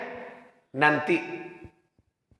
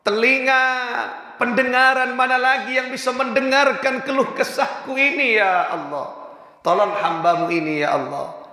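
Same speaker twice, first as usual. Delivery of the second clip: high, lamenting cry to God; tearful prayer; 7 semitones higher than usual. Acted. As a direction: plead to God through mild tears. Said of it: "pulang sambil menangis". (doa meratap dengan nada tinggi)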